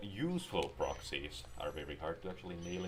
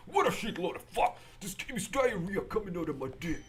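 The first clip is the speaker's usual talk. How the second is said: high, loud furious rant; 12 semitones higher, 4 dB louder than usual. Pretend. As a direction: scream it in hammed-up rage. Over-the-top rant.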